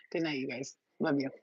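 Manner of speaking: ordinary talk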